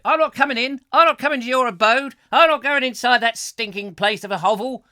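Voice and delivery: loud, high-pitched hammed-up refusal; mock indignant visitor. Visitor refusing to enter his house, mock indignation. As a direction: refuse with exaggerated outrage. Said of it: "she said".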